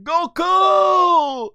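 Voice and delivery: high, loud anguished cry of a name; calling out in alarm; yelling Goku's name. Retold or imitated. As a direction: scream the name as friends watching him fall. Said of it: "going like".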